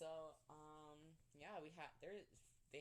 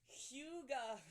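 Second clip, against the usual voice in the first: high, loud mock cheer; hammed-up high-pitched call; excited exclamation; 12 semitones higher, 7 dB louder than usual. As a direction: sing out the word in playful excitement. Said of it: "I was like".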